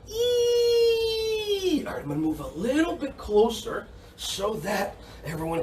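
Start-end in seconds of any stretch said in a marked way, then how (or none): 0.1-1.8 very high, stretched mouse squeak; squeaky mouse sound. Shown as a demonstration. Teacher leading face yoga, high mouse squeak. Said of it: "Everybody go like this and go".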